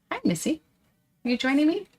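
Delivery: high sing-song voice for the dog; pet talk voice. Acted. Greeting the dog.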